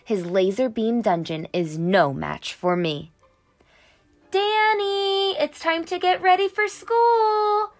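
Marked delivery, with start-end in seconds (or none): 4.3-7.7 high, bright-voiced mom calling from another room. A narrator's reading. Mom calls Danny to get ready for school, calling out. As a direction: call up the stairs like Mom. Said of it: "Mom calls out".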